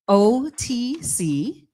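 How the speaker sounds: ordinary talk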